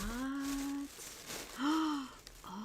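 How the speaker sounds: high, soft gasp of surprise; delighted gasp. Real, not acted. Seeing a friend's gift for the first time, delighted gasp. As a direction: draw in a high, hushed gasp.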